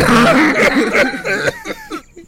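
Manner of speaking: exaggerated hacking cough with a high squeak; hammed-up choking cough after a long hold; dramatic cough. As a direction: cough it out like holding in a hit. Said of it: "did a dramatic representation of".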